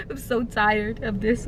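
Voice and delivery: ordinary talk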